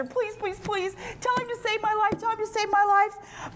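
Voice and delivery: high, repetitive pleading; imitated desperate begging; mock begging. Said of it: "he's begging Esther".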